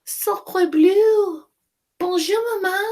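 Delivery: a newborn French duck; baby duck voice. Acted. Duckling greeting Jack as mother. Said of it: "and said".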